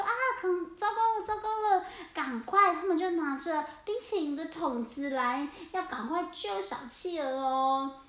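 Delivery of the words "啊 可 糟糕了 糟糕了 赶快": high, fast, loud alarm; panicked cats. Acted. Cats seeing the orca attack, loud panicked alarm. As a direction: shout the warning in a rush.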